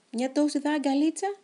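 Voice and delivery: high, small begging question; pleading. Acted. Hedgehog begging the station crowd for a little hug. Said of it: "παρακάλεσε".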